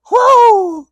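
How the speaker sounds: high, loud whoop; real whoop, very high and loud; joyful whoop. Real, not acted. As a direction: let out a big whoop of delight. Cracking up at the memory.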